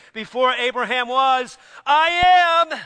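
high, loud proclamation of I am; climactic shout. Retold or imitated. Preacher voicing Jesus to the crowd, loud proclamation. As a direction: ring out the claim as Jesus.

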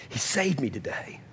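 soft, breath-only New Orleans convert's testimony; whispered awe. Retold or imitated. Saved man to his gathered family, whispered awe. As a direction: whisper it as if still amazed.

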